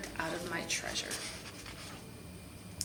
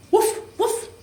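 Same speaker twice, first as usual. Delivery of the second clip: high, loud woof woof barks; dog charges out barking; 13 semitones higher, 10 dB louder than usual. A narrator's reading.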